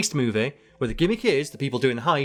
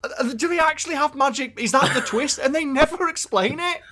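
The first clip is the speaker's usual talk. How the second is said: high, worked-up disbelief; incredulous; 11 semitones higher than usual. Real, not acted. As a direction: demand answers in mock outrage. Mock outrage at the film.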